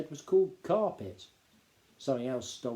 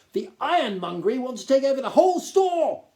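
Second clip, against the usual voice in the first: high, loud, self-important Duke lecturing; a pompous gnome lord talking down to the boy; 12 semitones higher, 10 dB louder than usual. Acted. Duke's pompous speech.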